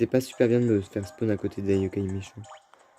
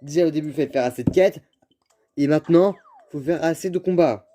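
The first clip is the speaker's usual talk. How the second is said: exasperated complaint; 6 semitones higher than usual. Real, not acted. Complaining about game rules.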